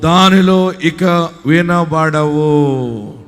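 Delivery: slow every syllable of వినబడవు to drive it home; drawn-out emphasis. Real, not acted. Pastor to congregation, closing the verse with slow heavy emphasis. Pastor drags out scripture ending.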